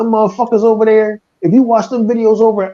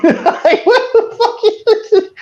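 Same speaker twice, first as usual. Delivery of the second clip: much higher, slow, laughing through it; much slower than usual. Real, not acted. Cracking up at his own bit to the co-host, laughing high. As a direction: laugh out the disbelief.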